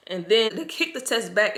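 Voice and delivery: usual speaking voice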